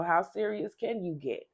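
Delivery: normal talking voice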